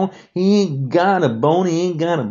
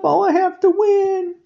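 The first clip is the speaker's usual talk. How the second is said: mock whiny crybaby; 3 dB louder than usual. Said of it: "It's like".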